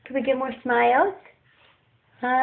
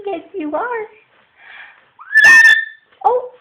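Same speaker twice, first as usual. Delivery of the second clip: higher, sing-song praise; 13 semitones higher, 10 dB louder than usual. Real, not acted. Praising baby, higher take.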